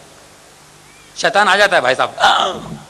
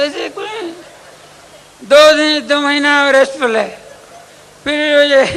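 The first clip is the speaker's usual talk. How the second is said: worried friend; 8 semitones higher, 3 dB louder than usual. Acted. Friend and patient exchange.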